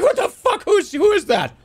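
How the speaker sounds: very high, flustered comic shock; acted startled alarm. Acts out being startled by shout.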